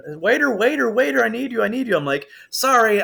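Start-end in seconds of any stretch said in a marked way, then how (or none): 0.1-2.0 high whiny customer calls; mock needy customers. Mimicking restaurant customers, high and whiny. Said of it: "I have tables all like". 2.5-3.0 mock sorry. Mocking quitting.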